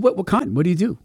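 a hint of the chatty neighbor; lightly voiced neighbor. Neighbor voiced pressing question.

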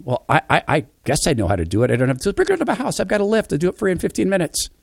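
high, quick eager friend voice; brushing it off, fast and high; friend's voice. Retold or imitated. Friend offers to do it.

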